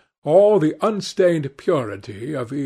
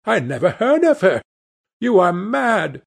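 higher-pitched, agitated protest; frightened denial; 5 semitones higher than usual. A narrator's reading. Cornered man denying the charge to the gunman, frightened. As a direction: protest anxiously, voice raised in pitch. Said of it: "grew sick with fear".

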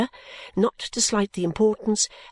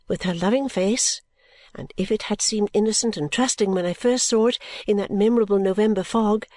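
usual speaking voice

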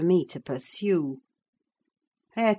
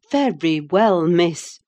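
louder, feigned anger hiding joy; mock angry; 7 dB louder, slower than usual. A narrator's reading. Nurse scolding the girl with mock anger. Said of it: "concealing my joy under an angry countenance".

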